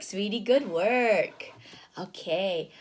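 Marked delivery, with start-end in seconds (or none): none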